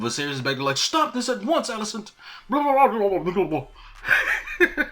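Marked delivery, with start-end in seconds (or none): 0.8-2.1 very high-pitched acted command; mock outcry. Viserys ordering Alicent to stop, high mock outcry. 2.5-4.9 high gibberish rant; mock babble. Host skipping the king's rant, high mock babble.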